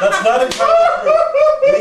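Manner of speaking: high squeal of laughter; laughing hard while denying it. Real, not acted. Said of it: "That sounds like you're laughing at him".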